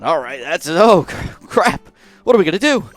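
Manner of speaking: mock panic, pitch climbing high; half-acted alarm breaking into a laugh; freaking out. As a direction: play up a retold panic, rising. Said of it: "I'm freaking out already".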